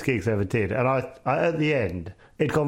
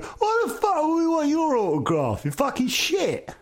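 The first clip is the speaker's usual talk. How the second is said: much higher, shouted heckler voice; 14 semitones higher than usual. Retold or imitated. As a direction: yell it like an angry heckler at the back. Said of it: "one bloke went".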